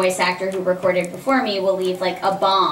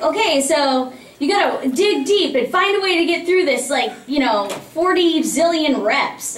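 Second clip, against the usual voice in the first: workout coach; 5 semitones higher than usual. Retold or imitated. Voice actor mimicking a vocal workout, pumped-up coach. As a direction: push them through a gruelling set of reps. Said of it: "it was like vocal workout".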